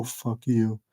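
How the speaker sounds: quiet, drawn-out, dismissive curse; slow fuck you. Acted. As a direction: let it drop low and lazy, mock contempt. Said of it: "flips Jake off with a slow".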